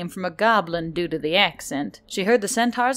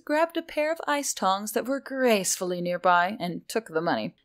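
usual speaking voice

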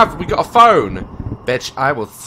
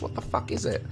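normal talking voice

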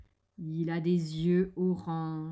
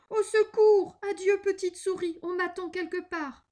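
very high cry, loud at first; panicked acted squeal, easing in volume; cried out; 11 semitones higher, 6 dB louder than usual. A narrator's reading. The fox sees the gruffalo, high cry for help. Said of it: "il cria".

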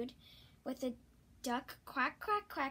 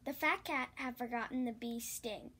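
usual speaking voice

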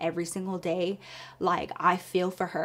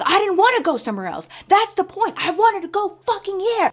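higher, louder, fast furious yell; furious shout; 12 semitones higher, 8 dB louder than usual. Retold or imitated. Husband yelling at his wife in the office, furious shout. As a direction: explode at her with it.

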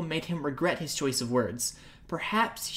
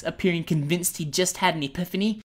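ordinary delivery

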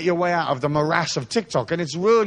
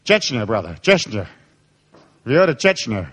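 lightly acted menacing challenge; mock intimidation; 5 semitones lower, slower than usual. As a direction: lean on it slowly, like a veiled threat. Models facing down a policeman.

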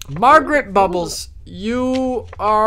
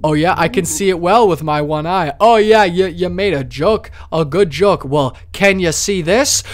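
ordinary talk

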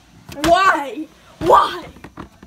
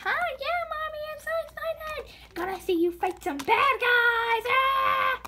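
put-on much higher, excited child voice; little daughter voice; 10 semitones higher than usual. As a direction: squeal like an excited little girl. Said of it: "I'm here with my daughter".

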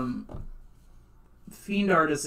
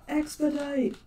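mock urgent call; 11 semitones higher, much slower than usual.

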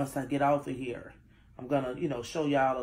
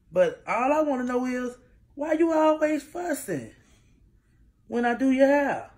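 exasperated; 8 semitones higher, 5 dB louder than usual. Real, not acted. Exasperated mom asks daughter.

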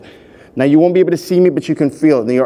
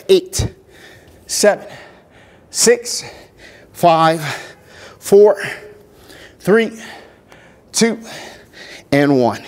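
energetic countdown; 4 semitones higher, much slower than usual. Real, not acted. Counting down final reps.

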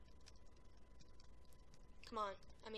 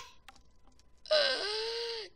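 shocked gasp; 9 semitones higher, 7 dB louder than usual. Real, not acted. Killed in game.

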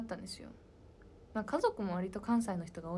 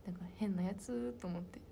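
usual speaking voice

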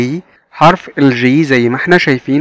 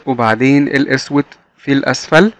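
ordinary talk

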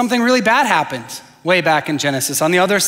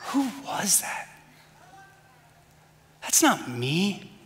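very soft, stunned aside; hushed self-questioning; 10 dB quieter, slower than usual. Retold or imitated. Dad questioning himself after yelling at his kids, hushed. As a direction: drop almost to a whisper, as if shocked at yourself.

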